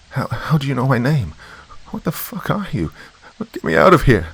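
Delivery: high, pleading, terrified whine rising to a cry; desperate whine. A narrator's reading. Trapped girl pleads with the shadow, high desperate whine. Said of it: "I whined desperately, my voice trembling".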